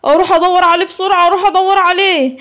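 an officer in a comic fluster; flustered policeman. Acted.

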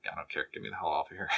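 low, quiet mock surrender; muttered giving up. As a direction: drop to a dejected mumble, re-enacting defeat. Retells giving up on his speech.

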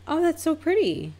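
very high-pitched cooing compliment; high, polite praise; polite admiration. Retold or imitated.

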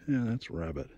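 ordinary talk

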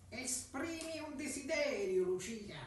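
high, slow purring cat voice. Acted. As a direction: speak as a sly talking cat. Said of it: "fece quella voce gattesca".

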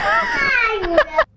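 very high shrieking laughter; helpless high cackle; squealing laugh. Real, not acted. Cracks up at own joke.

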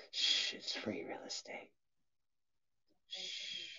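unvoiced whisper; hushed meme whisper. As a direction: breathe it out as a secret.

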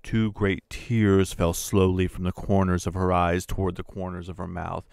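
ordinary delivery, nothing marked

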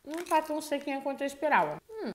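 talking while chewing popcorn; crunching sweet popcorn; mouth full, chewing. Real, not acted. Seen 2: Puts popcorn in mouth repeatedly, chews while talking.

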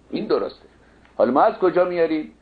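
ordinary talk

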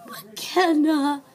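loud, thrilled call of the name; excited call. Real, not acted. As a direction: cry out her name with joy. Calling the doll's name, thrilled.

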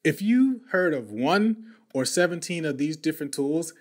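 ordinary talk, nothing marked

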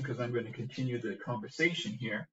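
usual speaking voice

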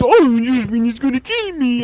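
high, mock-frightened voice; mocking panicky teammate. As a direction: imitate a player panicking over nothing. Mimics teammate's panic in game.

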